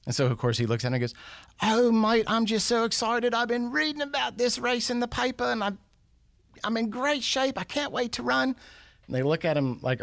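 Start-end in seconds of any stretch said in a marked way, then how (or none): 1.6-8.6 high-pitched, excited mock Aussie; amateur Australian impression, eager and high; bad Australian accent. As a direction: put on a hammed-up Australian voice, way up high. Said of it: "my bad attempt at an Australian accent".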